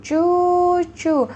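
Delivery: "Cucu." high, loud, long-held; long vowels. Shown as a demonstration. Teacher repeats "Cucu." for students, high and long. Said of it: "Ini panjang ya".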